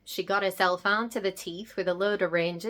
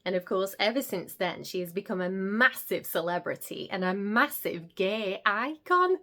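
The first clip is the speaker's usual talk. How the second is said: ordinary talk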